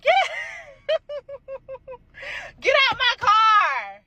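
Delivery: high, loud scream through laughter; frantic shriek at the thief, slow at the end. Retold or imitated. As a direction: yell at her to get out of the car.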